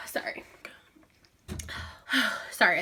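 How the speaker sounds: sighing, breathless apology; out of breath. Real, not acted. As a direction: catch your breath between words. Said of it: "running myself out of breath".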